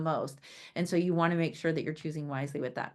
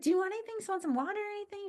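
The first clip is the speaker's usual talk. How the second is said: hostess offer; 12 semitones higher, much faster than usual. Retold or imitated. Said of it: "I was like".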